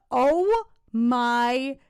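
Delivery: slow, loud, drawn-out disbelief; stunned oh my God. Real, not acted. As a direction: gasp it out, amazed. Sudden shock at anime list.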